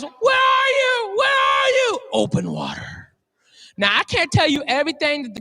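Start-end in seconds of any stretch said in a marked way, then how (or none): 0.0-2.0 high, slow desperate yelling; panicked shout. Retold or imitated. Husband lost at sea calling for his wife, slow high shout. 2.1-3.1 very deep, slow movie-trailer voice; trailer announcer. Retold or imitated. Comic retelling the movie preview, deep trailer announcer voice. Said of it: "the whole preview right here".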